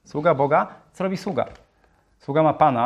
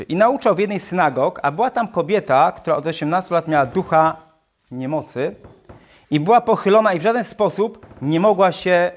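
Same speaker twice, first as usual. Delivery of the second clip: scripture reading; 4 semitones higher than usual. Acted. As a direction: proclaim the Gospel passage to the church. Reads scripture aloud. (podniosłe czytanie Ewangelii)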